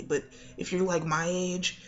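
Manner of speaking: ordinary delivery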